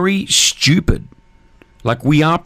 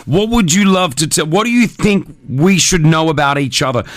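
ordinary talk, nothing marked

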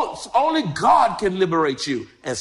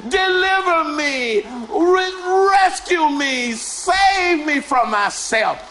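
acted prayer cry; 5 semitones higher than usual. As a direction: cry out to God, desperate. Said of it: "You cry out".

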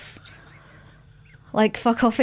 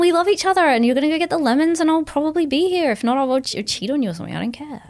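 defensive sarcastic; 6 semitones higher, faster than usual. Acted. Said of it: "Stop being fucking defensive".

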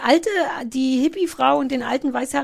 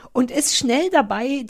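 usual speaking voice

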